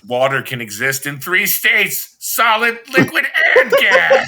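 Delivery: a dimwit suddenly grasping science, building to a laughing crow; mock revelation building. Dean's brain coming back.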